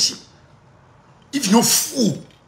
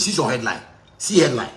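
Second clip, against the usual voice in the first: ordinary delivery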